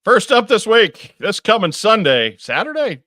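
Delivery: loud, high-pitched segment opener; announcer-style kickoff. Real, not acted. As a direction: belt it out to kick off the segment. Kicks off the show's calendar segment.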